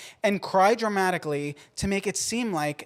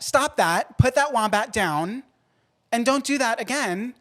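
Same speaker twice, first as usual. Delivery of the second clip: raised, higher voice telling her off; mock scolding; 6 semitones higher, 5 dB louder than usual. Scolding a friend who stole a wombat, raised and mock-stern. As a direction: scold her like a naughty kid. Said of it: "And to Samantha Jones".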